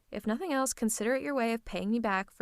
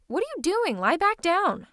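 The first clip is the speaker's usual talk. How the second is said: high, louder scolding command; alarmed order; 7 semitones higher, 6 dB louder than usual. Acted. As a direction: stop the patient getting up, urgently. Alarmed as patient gets up.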